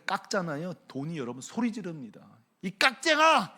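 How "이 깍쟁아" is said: high, loud mock cry; money yelling. Money crying out at the stingy boss, loud. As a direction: shout it as the cheated wages. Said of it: "이러고".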